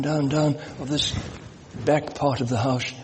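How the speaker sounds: ordinary talk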